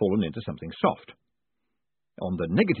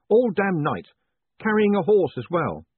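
higher, louder exasperated whine; exasperated outburst; 10 semitones higher, 5 dB louder than usual. Acted. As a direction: complain in exhausted disbelief. Exhausted complaint.

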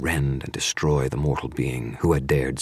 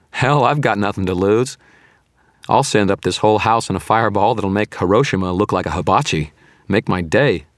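raised, loud bravado; cheerful tone; 5 semitones higher, 6 dB louder than usual. A narrator's reading. Wizard threatening to blow up the house, cheerful. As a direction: dare them cheerily with a lifted voice. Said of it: "I said in a cheerful tone".

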